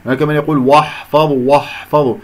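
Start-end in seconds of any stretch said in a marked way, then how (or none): 0.6-1.5 واحفظوا with a thick waw and ha; heavy "woh" starting واحفظوا; mistaken heaviness. Shown as a demonstration. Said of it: "وأنا فخمت الواو والحاء". 1.5-2.2 واحفظوا with a thick waw and ha; heavy "woh" starting واحفظوا; mistaken heaviness. Shown as a demonstration. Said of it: "وأنا فخمت الواو والحاء".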